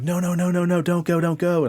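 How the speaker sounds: high, rapid-fire pleading; retold frantic begging. Dreamer begs grandmother not to leave, high panicked pleading. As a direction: imitate begging someone desperately to stay. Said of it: "begging her not to go".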